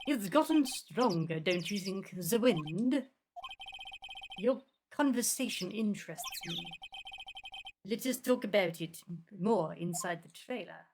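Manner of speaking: slow, put-on French accent; measured, deliberate French-accented voice. Vasquez inviting them into the trailer, French accent. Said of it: "My French accent is amazing".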